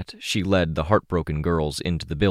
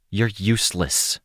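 snarling; 5 semitones higher than usual. A narrator's reading. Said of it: "I snarled the words".

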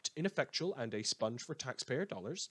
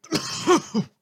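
loud hacking cough, way up high; harsh coughing fit; 15 dB louder than usual. Acted. Radio host hacking helplessly on air, loud harsh cough. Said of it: "penalties of live broadcasting".

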